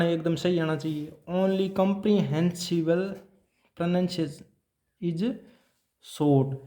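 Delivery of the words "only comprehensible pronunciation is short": very slow and spaced; slow dictation. Shown as a demonstration. Teacher reading a point aloud for copying, slowly. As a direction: dictate carefully for the notes. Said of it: "teacher dictating a principle".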